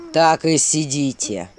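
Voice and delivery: low, slow, menacing command; menacing prince voice. Acted. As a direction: loom over them with a dreadful voice. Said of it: "страшный голос князя".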